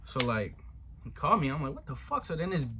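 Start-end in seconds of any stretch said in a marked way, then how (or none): 1.8-2.2 startled. Retold or imitated. Retold shock at the phone call.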